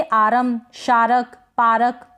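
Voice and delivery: "आरम, शारक, पारक" में हर र पूरा; गलत मिसालें "आरम, शारक, पारक", र के बाद अ; पूरा र, गलत रूप. Shown as a demonstration. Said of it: "तो ये गलत हो जाएगा". ("आरम, शारक, पारक" को दो-दो हिस्सों में तोड़कर)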